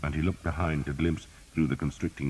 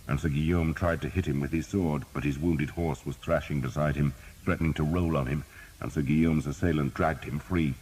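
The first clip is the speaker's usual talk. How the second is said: ordinary delivery, nothing marked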